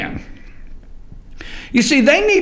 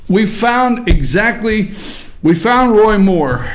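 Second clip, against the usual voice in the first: normal talking voice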